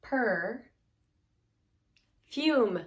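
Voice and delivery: Per and fume said as two separate beats with a pause; syllable by syllable. Shown as a demonstration. Teacher splits perfume into syllables for students. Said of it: "Get the first part with the er".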